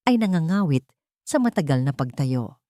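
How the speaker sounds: ordinary delivery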